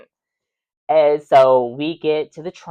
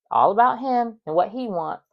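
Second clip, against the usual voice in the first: ordinary talk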